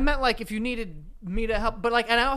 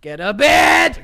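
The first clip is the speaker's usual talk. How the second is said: shouting; 12 dB louder, much slower than usual. Pretend. Mock wrestling hype yelling.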